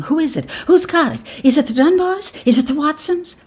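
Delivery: high, eager flurry of questions; excited questioning. A narrator's reading. Women all ask who got it, high and excited. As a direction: ask like a crowd of curious women.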